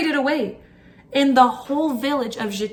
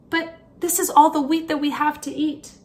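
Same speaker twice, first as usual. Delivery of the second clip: put-on higher, pleading young girl; girl's voice; 6 semitones higher than usual. A narrator's reading. Marusia explaining to the stork that the wheat is all they have, in a higher girl's voice. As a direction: plead gently with the bird.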